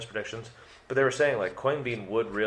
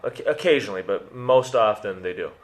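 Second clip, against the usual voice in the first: normal talking voice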